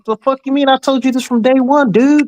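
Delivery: high-pitched exasperated rant; exasperated outburst. Retold or imitated. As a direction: let the outrage spill over.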